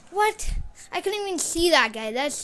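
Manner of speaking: high, loud disbelieving yelp; shocked outburst. Real, not acted. Gamer yelling at an unseen killer in shock. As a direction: shout in sudden disbelief at dying.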